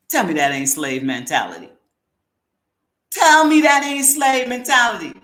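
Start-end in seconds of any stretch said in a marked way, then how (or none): 3.1-5.1 louder and higher, hammering the repeat; emphatic repeat. Real, not acted. Host challenges viewers on slave mentality, loud and higher. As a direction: throw it back at them, demanding an answer.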